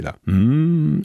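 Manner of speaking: usual speaking voice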